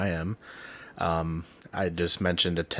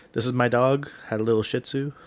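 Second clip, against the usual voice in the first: usual speaking voice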